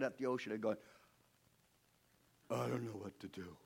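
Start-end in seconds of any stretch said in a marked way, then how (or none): 2.5-3.6 low, hushed, lost Moses; quiet helplessness. Acted. Moses staring at the ocean, quietly at a loss. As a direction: say it softly to yourself, stumped. Moses alone before the sea, stuck.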